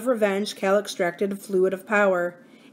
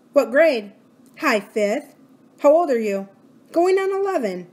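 put-on young girl; 6 semitones higher, 5 dB louder than usual. A narrator's reading. Quick exchange, girl's replies.

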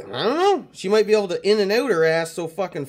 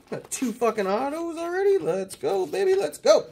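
excited; 10 semitones higher than usual. Real, not acted. Hyped pulling autograph cards.

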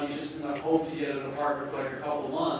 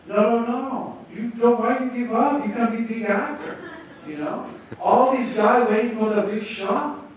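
Tommy voice; 7 semitones higher, 4 dB louder than usual. Retold or imitated. Tommy urging him not to quit.

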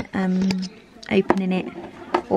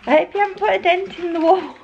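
put-on prim old-person voice; 10 semitones higher, faster than usual. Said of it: "an old person thing to say".

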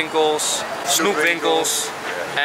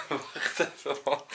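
stifled laugh; 12 dB quieter, slower than usual. Real, not acted. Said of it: "zonder te lachen".

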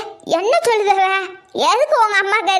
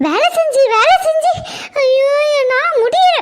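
exasperated whine; 7 semitones higher, 4 dB louder than usual. Acted. Daughter-in-law exhausted by chores.

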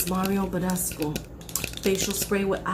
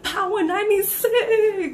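high, loud laughing shout; excited shout; 9 semitones higher, 6 dB louder than usual. Acted. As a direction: yell it with joy. Winner shouts station.

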